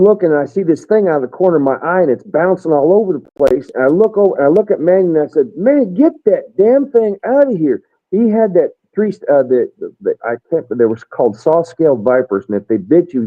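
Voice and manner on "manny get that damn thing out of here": high, slow, emphatic mock order; mildly alarmed retold command. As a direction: pitch it up, drawing out each word. Retold alarmed order about a snake.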